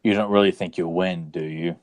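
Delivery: low, menacing movie villain; menacing villain quote. Acted. Quoting a villain line for a guessing game, low and menacing. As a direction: deliver it like a film villain taunting.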